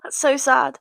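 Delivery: ordinary talk, nothing marked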